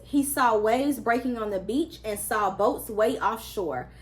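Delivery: ordinary delivery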